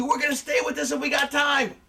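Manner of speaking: frantic high-pitched commentator call; Jim Ross. Retold or imitated. Retelling commentary as the angle builds, crazed excitement. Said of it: "Jim Ross is going crazy".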